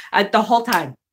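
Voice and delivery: usual speaking voice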